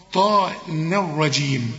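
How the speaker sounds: ordinary talk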